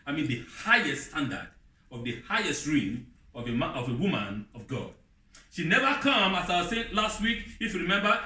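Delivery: usual speaking voice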